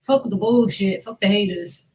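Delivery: usual speaking voice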